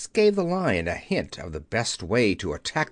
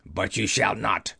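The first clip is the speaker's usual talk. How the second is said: low, rough refusal; 4 semitones lower than usual. Acted. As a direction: flatly forbid them, low and gruff. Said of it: "replied the voice".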